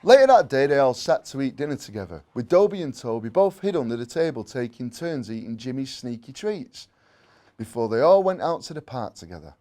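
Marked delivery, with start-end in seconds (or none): none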